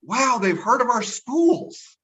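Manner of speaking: high, slow, drawn-out wonder; mock amazement. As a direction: marvel slowly, pitched well above normal. Acts own amazed reply.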